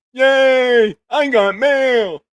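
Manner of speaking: high, loud, drawn-out puppet cheer; Crank Yankers character. Acted. Host quoting the Crank Yankers mail catchphrase, high, loud and stretched out. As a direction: shriek it slowly in a silly prank-call puppet voice. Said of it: "Crank Yankers".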